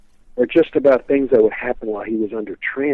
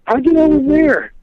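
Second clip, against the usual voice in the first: much higher, amazed question; a mock gasp of disbelief in the words; astonished clerk; 14 semitones higher, 4 dB louder than usual. Host imitating the pharmacist's amazement, high and stunned.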